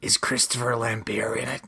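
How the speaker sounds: breathy whispered question, no voice at all. Real, not acted. Friend whispering a question about the show, hushed.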